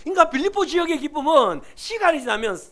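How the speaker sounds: usual speaking voice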